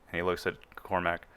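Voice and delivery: normal talking voice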